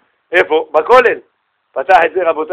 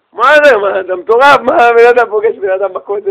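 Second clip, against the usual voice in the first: loud, higher-pitched disbelieving questions; incredulous outburst; 4 semitones higher, 4 dB louder than usual. Retold or imitated. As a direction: blurt it out as if he's crazy. Poor man disbelieves the stranger's gift. (התפרצות נדהמת ולא מאמינה)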